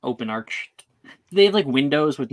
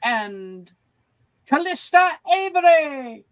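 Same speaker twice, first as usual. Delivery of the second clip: high, drawn-out final introduction; grand announcer; 5 dB louder, slower than usual. Acted. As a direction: build to the last name and ring it out. Announces last victor.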